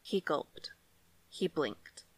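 usual speaking voice

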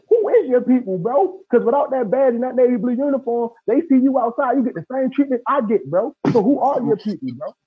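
high, loud, heated retelling of a confrontation; lost temper, retold. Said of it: "that's the only time I lost my temper".

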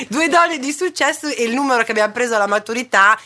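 high burst of laughter into words; laughing. Real, not acted. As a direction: laugh through the self-mockery. Laughing at their own success.

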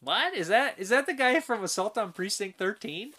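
high, startled disbelief; surprised. Retold or imitated. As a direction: do a double take at the cast. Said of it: "I was like".

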